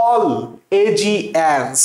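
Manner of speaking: "all এ জি এনস" said slowly as separate pieces; deliberately broken pronunciation. Shown as a demonstration. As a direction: break it into "all", "এ", "জি", "এনস", drawn out. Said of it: "এলিগেন্সটাকে কিভাবে পড়বো".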